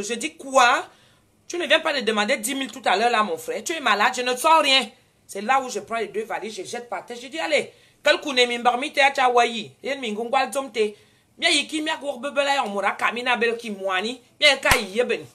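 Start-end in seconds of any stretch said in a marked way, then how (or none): 0.4-4.9 loud, higher, outraged yelling; indignant shout. Retold or imitated. Retold shouting at porter. 7.4-15.2 scolding in patois. Retold or imitated. Scolding him in local language.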